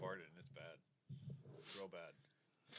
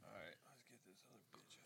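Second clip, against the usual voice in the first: whispering; 5 semitones lower, 26 dB quieter, faster than usual. Real, not acted. Whispered aside.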